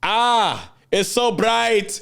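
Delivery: high, slow, dazzled ah into it's so bright; squinting into bright light. Pretend. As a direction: gasp up at a blinding light, stretched out. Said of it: "squinting your eyes".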